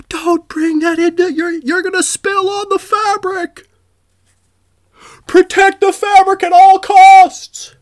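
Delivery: very high, loud grandma shout; shrill old lady yell. Retold or imitated. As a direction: shriek it like a furious granny. Voicing yelling grandmothers.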